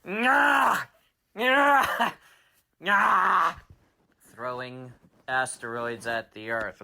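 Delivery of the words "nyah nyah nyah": loud, high grunts of hurling; villain's effort yells. Acted. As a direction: yell with each furious throw. Villain hurling asteroids in a tantrum.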